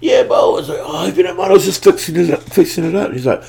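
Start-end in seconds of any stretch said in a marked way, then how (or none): 0.0-0.5 eager reply. Retold or imitated. Self quote replying up. 0.8-3.1 calling up. Retold or imitated. Caught fixing the rail.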